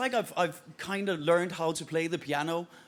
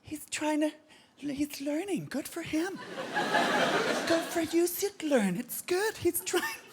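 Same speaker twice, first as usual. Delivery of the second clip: mock patronising encouragement; 9 semitones higher, slower than usual. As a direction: coo encouragingly at a struggling beginner. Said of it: "you're like".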